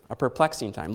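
ordinary talk, nothing marked

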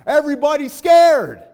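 slow, loud preacher's cry; shouted. Real, not acted. Preacher crying out to the congregation, loud and slow. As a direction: shout it out slowly to the church.

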